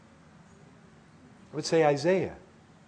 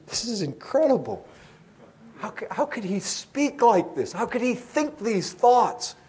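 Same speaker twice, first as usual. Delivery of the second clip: marveling, pitch climbing toward the end; a touch of incredulous awe, slowing at the end; mild awed amazement; 5 semitones higher than usual. Retold or imitated. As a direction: relive a new believer's wonder. Recalls amazement as new believer.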